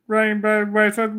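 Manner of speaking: ordinary delivery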